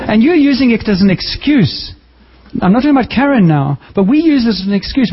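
preacher rebuking excuses. Acted. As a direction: call them out on their excuses. Rebuking the congregation.

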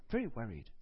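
low, falling note of grave worry; serious, concerned. Acted. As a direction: sound gravely concerned, pitch dropping. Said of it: "you sound very serious and very s-, concerned".

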